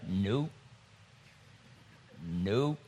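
low, terse mock refusal; holding back tears. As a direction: shut the feeling down in a deep voice. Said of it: "I started to cry. I was like".

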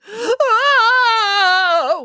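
high, loud melodramatic howl; long wail. A narrator's reading. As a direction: let out a long distraught wail. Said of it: "wailed Missus Bellamy".

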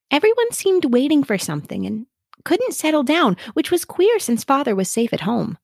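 a hint of a puzzled young sister; Amy's line. Amy speaks in the story.

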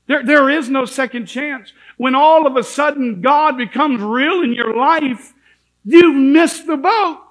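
loud, high, slowing on the final words; fiery preacher rising to a peak; preaching climax. Acted. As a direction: hammer home missed the boat.

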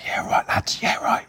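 hushed whisper of a man at his door; imitated furtive whisper. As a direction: whisper it low and hurried. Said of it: "we're whispering".

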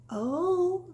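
plain reading voice

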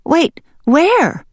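high, startled hen voice; high-pitched anxious question; confused hen. Acted. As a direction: call after someone in confusion. Hen left behind by mallard.

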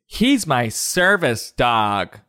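low, slow smug claim; mock entitled dog owner; entitled parent. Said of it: "they didn't just say".

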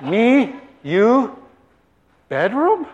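each word swooping upward; suggestive questioning. Acted. Elizabeth deciphers her husband's gestures, coyly questioning. Wife guesses mimed news, comic.

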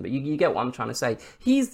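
normal talking voice

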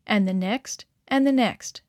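ordinary delivery, nothing marked

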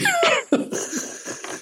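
squealed high laugh; high squealing laugh. Real, not acted. Granddad laughing at his own story, high squeal. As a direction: let a high laugh burst out.

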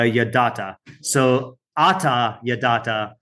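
ata yadata said slowly, each syllable clear; a teacher spelling out ata yadata; slow Hebrew phrase. Shown as a demonstration. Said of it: "usually you would just say".

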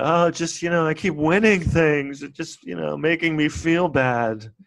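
hammed-up sad sack; mock weepy. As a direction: whine through fake tears. Tom weepily answering.

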